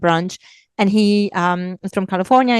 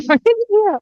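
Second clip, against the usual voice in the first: laughing answer; 13 semitones higher, 4 dB louder than usual. Retold or imitated. Laughing reply about her stomach.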